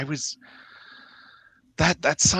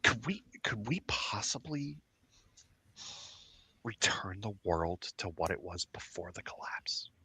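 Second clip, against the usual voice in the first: hushed excited whisper; 2 semitones higher, 8 dB quieter than usual. Acted. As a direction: whisper the big idea. Whispered conspiracy between characters.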